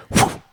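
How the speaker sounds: quick breathy whoosh; whoosh sound effect. Shown as a demonstration. Podcaster showing off a little sound effect, whoosh. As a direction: imitate something zipping by with a blown whew. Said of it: "I did a little sound effect".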